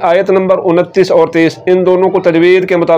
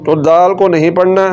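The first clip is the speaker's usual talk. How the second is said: normal talking voice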